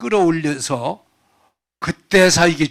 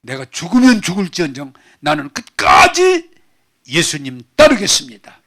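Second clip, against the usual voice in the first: loud vow; 8 dB louder than usual. Acted. Peter vowing loyalty.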